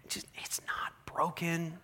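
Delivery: whispered thought to himself; inner whisper. Retold or imitated. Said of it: "and I'm thinking".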